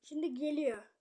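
usual speaking voice

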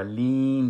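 "لین" slow, with a long held "ee"; smiling kasra. Shown as a demonstration. Teacher shows how the lips should go, "لین" drawn out with smiling lips. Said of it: "مثل خنده وار شوه".

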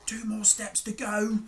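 high-pitched crook's voice; put-on voice of a storybook thief; high villain voice, almost at the goal; thief Hugh. A narrator's reading. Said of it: "said Hugh".

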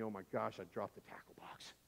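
low, fading to a near whisper; hushed panic. Retold or imitated. Boy realising he dropped the tackle box, hushed panic. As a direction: voice sinking in dread as it dawns. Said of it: "then the panic hits me".

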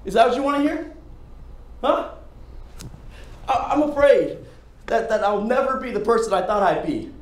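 high, loud desperate yelling; anguished shouting. Acted. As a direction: shout out the confession in despair. Audition monologue, shouted confession.

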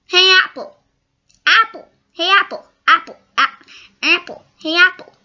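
high, loud, pestering calls; Annoying Orange. Retold or imitated. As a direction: pester someone with short repeated high shouts. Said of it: "And Annoying Orange".

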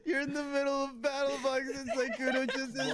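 high-pitched, barely holding back laughter; laughing hard. Real, not acted. Gamer retelling friend's remark mid-battle, laughing hard. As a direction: retell it while cracking up. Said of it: "why is he laughing so much".

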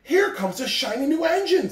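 high, bright clown voice; funny little clown. Acted. Toy clown spotting the shiny engine, high and excited. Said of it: "said the funny little clown".

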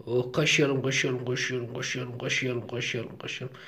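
hushed lullaby-like murmur; tender cooing. Real, not acted. Cooing while scratching the chick.

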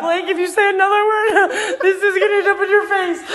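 threatening through laughter; half-laughing warning; laughing threat. Real, not acted. Laughing threat during prank.

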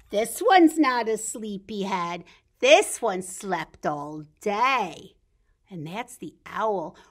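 lively storybook read-aloud, slowing at the ends; bright read-aloud for toddlers; reading to children. Acted. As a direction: read the picture book to the little ones. Reads picture book to children.

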